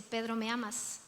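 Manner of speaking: Pedro, ¿me amas? asked softly and low; soft gentle question. Acted. Jesus gently asking Peter at the shore, soft and quiet. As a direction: ask it quietly, almost tenderly.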